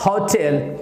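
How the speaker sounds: "होटल" as hau-tel, two clear syllables; "होटल" ending in clear "tel"; diphthong. Shown as a demonstration. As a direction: model "होटल" with gliding first vowel. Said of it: "यानी कि आपको बोलना पड़ेगा".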